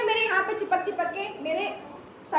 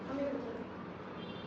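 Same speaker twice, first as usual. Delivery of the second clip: slow, breathy fading plea, much softer; frightened whisper; 3 semitones lower, 14 dB quieter, much slower than usual. Retold or imitated. Girl whispering to her mother, frightened, begging to go home. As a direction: whisper it like a scared child tugging at mum. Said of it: "mummy का हाथ पकड़ा".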